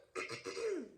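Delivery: a policeman's throat clear, falling away. Acted. Officer clearing his throat before the crowd, throat clearing. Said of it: "The officer cleared his throat".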